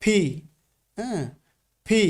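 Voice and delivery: ফি as "phi", a p with a puff of air; careful model of ফি, not an f; aspirated P. Shown as a demonstration. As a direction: press the lips, then release ফি with breath. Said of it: "আমি আবারও উচ্চারণ করছি".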